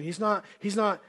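ordinary talk, nothing marked

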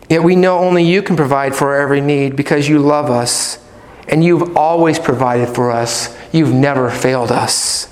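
hushed sincere prayer. Shown as a demonstration. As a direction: pray it gently to God. Models a trusting prayer.

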